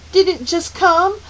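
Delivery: put-on high, loud, distressed girl; agonized question. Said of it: "Meg asked in agony".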